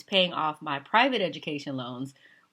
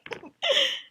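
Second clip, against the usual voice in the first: strained laugh; 6 dB louder than usual. Real, not acted. Strained laugh after fake calm.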